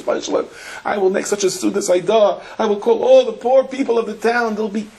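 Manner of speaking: rising, desperate vows, pitched high; frantic high pleading. Retold or imitated. Sailor in the storm promising God a feast, frantic high pleading. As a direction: bargain with God like the ship is going down.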